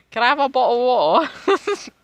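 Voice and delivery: high, loud mock-British, no T in "water"; British "bottle of water". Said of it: "don't pronounce your T's at all".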